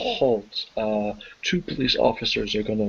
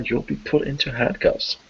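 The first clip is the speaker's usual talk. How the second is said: ordinary talk, nothing marked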